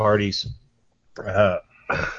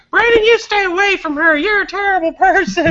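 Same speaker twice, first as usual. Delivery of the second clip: very high, louder mock scolding woman; scolding office lady; 6 dB louder than usual. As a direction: squeal it like a shocked prim coworker. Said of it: "Ann ... is like".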